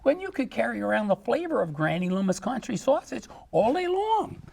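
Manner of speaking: high, scolding granny falsetto; cartoon granny selling sausage; Granny Loomis. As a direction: wag a finger like a sly old grandma. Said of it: "She says".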